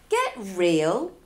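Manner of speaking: loud scornful dismissal; sharp mocking put-down; scoffing. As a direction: brush him off with a scoff. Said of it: "I scoffed".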